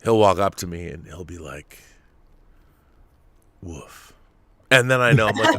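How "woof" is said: soft, low little woof, much quieter; a tiny polite woof from a hungry dog; really quiet woof. Retold or imitated. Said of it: "Like, really quiet".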